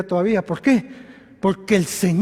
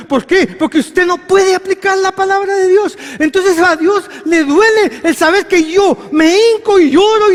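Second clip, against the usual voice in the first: higher and louder, rising to a cry; full-force preaching; 9 semitones higher, 7 dB louder than usual. Acted. Preacher confronting weak faith, full force. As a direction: let it build to a shout.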